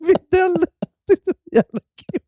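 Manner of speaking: high, squeezed words through laughter; real laughing fit. Real, not acted. Repeating own pun to a friend, helplessly laughing. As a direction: barely get it out for laughing.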